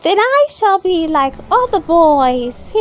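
put-on high, eager boy puppet voice; a wooden boy asking his father for a book; bright puppet voice pitched well up; Pinocchio. A narrator's reading. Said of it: "said Pinocchio".

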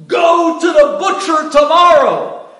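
husband in a dream shouting at his wife, terrified; screaming at the top of his lungs. Retold or imitated. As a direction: shout it down at her, desperate. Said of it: "screaming on the top of his lungs".

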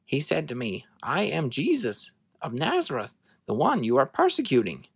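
ordinary delivery, nothing marked

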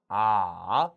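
아 dipping low then rising; third tone. Shown as a demonstration. Teacher demonstrating the third tone of 아, dipping. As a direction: drop 아 low, then let it climb back. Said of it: "삼성".

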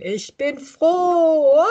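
very slow, high and laughing; joyful laughing cheer. Shown as a demonstration. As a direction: stretch it out on a big laugh. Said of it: "es geht los mit".

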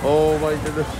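ordinary delivery

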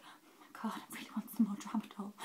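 hushed, breathy mock longing; mock craving. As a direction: sigh it out as if yearning for a treat. Craving painkillers, acted in a story.

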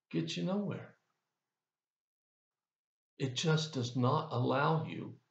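ordinary delivery

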